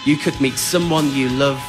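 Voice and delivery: ordinary talk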